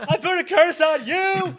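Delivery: high, loud, slow mock curse; yelling Eddie Murphy. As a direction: bellow the curse like Mushu. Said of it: "I do enjoy a yelling Eddie Murphy".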